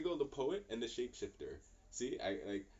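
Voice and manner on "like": ordinary talk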